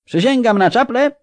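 very high, loud yell; a second knight yelling his vow; yelled oath. Acted. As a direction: yell it out far above normal pitch. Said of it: "wrzasnął drugi rycerz".

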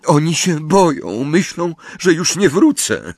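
acted sobbing, pitched right up. A narrator's reading. Weeping mother fears for her children, high and sobbing. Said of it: "zaszlochała jeszcze głośniej".

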